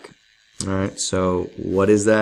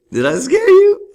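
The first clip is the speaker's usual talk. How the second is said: much higher, loud teasing squeal; squeaky high tease; 9 dB louder than usual. Pretend.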